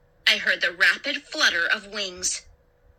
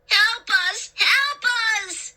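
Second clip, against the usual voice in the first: high, desperate cries for help; frantic high-pitched yelling; pleading at top of lungs; 10 semitones higher, slower than usual. A narrator's reading. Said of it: "plead at the top of his lungs".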